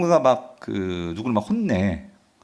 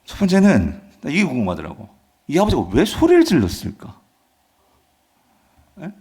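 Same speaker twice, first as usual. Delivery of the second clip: normal talking voice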